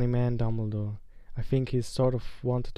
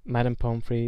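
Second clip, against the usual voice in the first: plain reading voice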